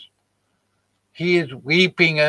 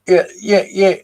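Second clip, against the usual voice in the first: dismissive; 7 semitones higher, 9 dB louder than usual. Real, not acted. Brushing off a viewer's comment.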